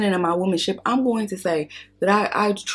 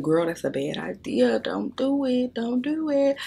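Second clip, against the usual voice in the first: inner voice warning; 6 semitones higher, 4 dB quieter than usual. Retold or imitated. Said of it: "a part of me is saying".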